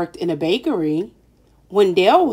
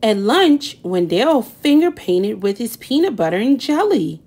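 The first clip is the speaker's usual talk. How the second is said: ordinary delivery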